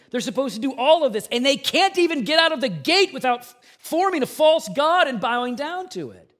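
fervent sermon climax; impassioned preaching. Real, not acted. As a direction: drive the warning home with rising passion. Preaching builds to a passionate peak.

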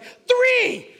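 very high, loud yell; child's leaping shout. Retold or imitated. Son jumping before daddy is ready, shouting. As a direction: imitate a toddler launching himself. Toddler yells while leaping at father.

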